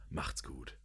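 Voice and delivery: soft whispered goodbye; whispering. Acted. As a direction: breathe it out in a whisper.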